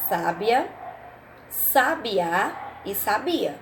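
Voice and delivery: each word slowed to show its stressed syllable: "Sábia" on SÁ, "sabiá" on Á, "sabia" on BI; contrasting stress. Shown as a demonstration. Teacher to pupils, slowly contrasting the stressed syllables. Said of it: "As três escrevem da mesma forma".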